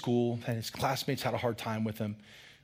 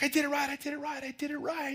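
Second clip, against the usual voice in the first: put-on fast, high child insisting; a boy rushing to defend himself, high; upset child; 12 semitones higher, faster than usual.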